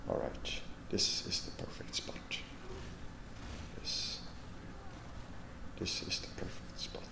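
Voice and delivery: hushed whisper. Real, not acted. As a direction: whisper from the hiding spot. Hiding in disguise.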